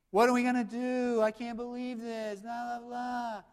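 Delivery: mock whining. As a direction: make fun of the grumbling crowd. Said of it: "the whining showed".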